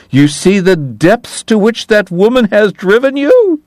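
high, mocking church-lady voice; triumphant mimicry. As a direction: ham up the pious scold. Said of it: "Kate mimicked triumphantly".

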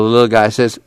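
plain reading voice

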